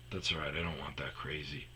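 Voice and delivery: low whisper to yourself; barely audible self-consolation; whispered aside. Real, not acted. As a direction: talk yourself out of it under your breath.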